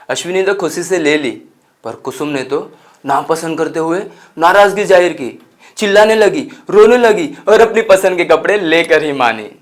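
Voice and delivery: high, loud, building narration; animated retelling of a tantrum. Acted. Narrator recounts Kusum's fuss over a dress, animated.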